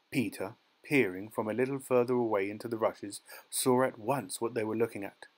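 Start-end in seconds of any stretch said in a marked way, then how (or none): none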